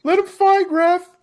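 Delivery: high-pitched heckler's holler; imitated fan yelling. Fans yelling at referee Keith Peterson, high imitated holler. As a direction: holler it at the ref like an annoying spectator. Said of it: "other ones that were yelling".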